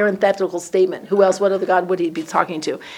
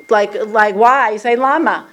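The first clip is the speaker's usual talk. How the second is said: ordinary talk, nothing marked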